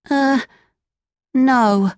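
put-on high, hesitant boy voice; hesitant Pinocchio. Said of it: "replied Pinocchio".

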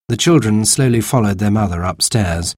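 ordinary delivery, nothing marked

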